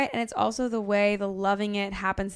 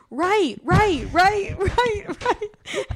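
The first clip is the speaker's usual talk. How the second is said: higher, louder urgent calling; frantic repeated right; 10 semitones higher, 3 dB louder, much slower than usual. Retold or imitated. Passenger urging driver at an exit, frantic calls. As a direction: hurry the driver with rising panic. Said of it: "they were like".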